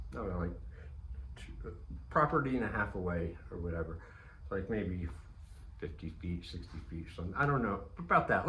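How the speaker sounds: usual speaking voice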